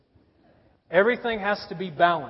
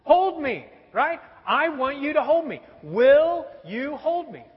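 put-on pleading toddler; 7 semitones higher, 5 dB louder than usual. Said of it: "what do you think that means?".

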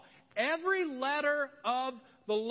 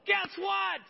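high, loud, thrilled shout of good news; excited Mary; 10 semitones higher, 5 dB louder, slower than usual. Acted. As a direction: burst out with the big news. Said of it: "She's like, ... she's excited".

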